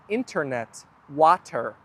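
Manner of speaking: "internet" and "water" with every t sounded; very proper pronunciation. Shown as a demonstration. Streamer imitating non-native speakers, crisp t in "internet", "water". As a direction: articulate "internet", "water" word by word. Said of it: "they are very proper".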